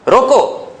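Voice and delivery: रोको set apart as a high, falling command; high, self-contained रोको; stop, then pause. Shown as a demonstration. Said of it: "अंदाज़े बयां की बात आ जाती है".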